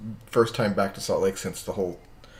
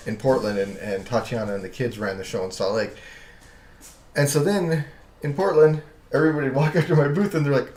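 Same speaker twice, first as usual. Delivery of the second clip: ordinary delivery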